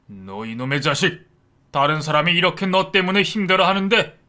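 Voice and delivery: loud, high furious scolding; a father roaring at his lying son. A narrator's reading. Father scolding his son for lying, furious loud shouting. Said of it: "그 모습에 아버지가 아주 많이 화가 나셨답니다".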